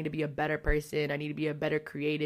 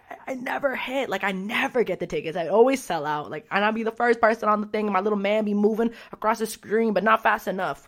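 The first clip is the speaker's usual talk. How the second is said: loud, frustrated rant; exasperated loud complaint; 4 semitones higher, 6 dB louder than usual. Real, not acted. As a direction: vent about losing the tickets, loud. Frustrated rant about ticket sales.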